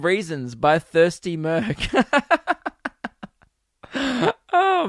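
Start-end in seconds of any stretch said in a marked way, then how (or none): 1.8-3.3 real high giggling; high laugh. Real, not acted. Amused by his own old note, high laugh.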